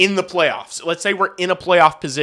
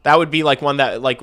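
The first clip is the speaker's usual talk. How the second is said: ordinary talk, nothing marked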